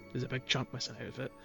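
ordinary delivery

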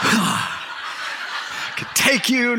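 slow whispered tough-guy joke, half laughing; slow joking whisper. Real, not acted. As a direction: mutter it slowly as a secret boast, chuckling.

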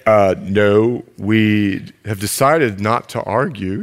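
low, slow, self-satisfied answer; smug groom. Retold or imitated. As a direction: calmly claim perfection. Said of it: "have you guys ever had an argument?".

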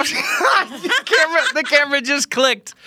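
high laughing words. Real, not acted. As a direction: laugh through it, slowly. Laughing at camera click.

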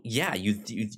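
ordinary talk, nothing marked

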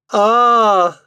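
high, loud, drawn-out cry; theatrical high moan of dread; distressed wail. Acted. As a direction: cry out in anguish. Distressed wife wails.

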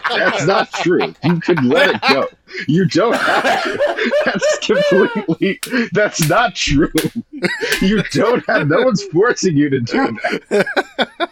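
high-pitched laughing objection; laughing protest. Real, not acted. Host begging co-host to drop the bit, high laughing protest.

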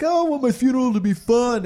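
high, loud, airy mock voice; mock naive voice. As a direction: mimic a naive cheerful person. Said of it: "everyone's like".

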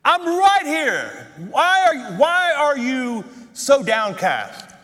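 high, slow, drawn-out call; imagined God calling down, stretching the words; God calling from heaven. Acted. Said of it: "I can imagine God up in heaven going".

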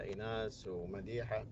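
ordinary delivery

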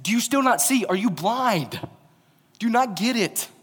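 high, loud, incredulous cry; exasperated outburst. Retold or imitated. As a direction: throw up your hands at their blindness. Jesus exasperated at blind disciples.

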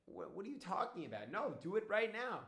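acted angry demand, pitch jumping high on No. Woman refusing Dodi's excuse about her arm, angry. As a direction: voice the woman's rising irritation. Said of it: "angry and she said".

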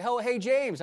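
retold excited hail, pitched well up; excited greeting. Woman greeting the preacher in church, excited.

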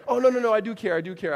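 loud, high backpedalling; mock protesting. Caught listener insisting they do care, loudly protesting. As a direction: cry out a hammed-up denial.

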